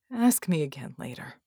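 low, husky, lightly amused; a touch of a chuckle in a rough voice; mildly amused, croaky murmur; chuckling. A narrator's reading. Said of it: "still chuckling".

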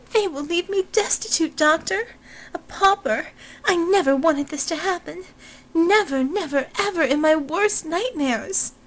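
hammed-up weeping plea, pitched high; sobbing. Robinson pleading to Doctor Dusk, hammed-up high sobbing. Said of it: "She broke down into a fit of sobbing".

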